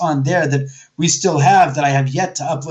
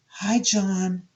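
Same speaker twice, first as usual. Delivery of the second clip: gently raised pitch, hushed, lightly imitating a spirit; soft, whispery voice; 4 semitones higher, 7 dB quieter than usual. Ghostly girl greeting the friend upstairs, soft and whispery. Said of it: "just this soft, whispery voice".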